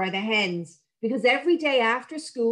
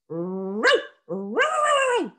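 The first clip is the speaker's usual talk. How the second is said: dog barking; much slower than usual. Acted. Sheepdog Meg barking at the hedge. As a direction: bark like a sheepdog. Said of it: "began to growl and bark".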